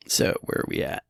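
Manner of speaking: softly whispered to himself; whispered aside. Real, not acted. Host whispering to himself while finding his place. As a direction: drop to a whisper, checking notes.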